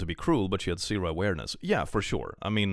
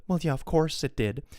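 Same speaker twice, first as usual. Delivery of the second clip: high, lightly snippy retort; a hint of a defensive snap; reading her sassy reply with a lift; 8 semitones higher than usual. Voicing the poster's snippy reply.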